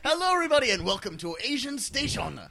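co-host hamming up a fake radio show intro, mock DJ voice; cheesy radio DJ. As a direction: sell the station like an over-the-top radio host.

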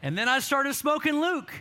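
retold testimony, mounting excitement; building confession. Pastor's comic confession builds up.

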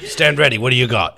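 sparring challenge. Acted. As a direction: call out a combat drill to a nervous trainee. Acted sparring challenge after a push.

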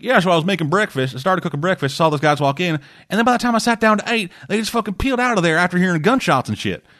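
voz imitada, más aguda, de un testigo; testigo imitado. Retold or imitated. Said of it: "one of the guys is like".

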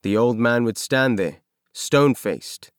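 ordinary delivery, nothing marked

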